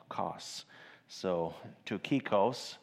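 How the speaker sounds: "Tokhikos" slow, accent landing on "kos"; stress on last syllable. Shown as a demonstration. Preacher demonstrates the Greek stress, slow and careful. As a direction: lean on the final "kos" of "Tokhikos". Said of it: "accent on the last".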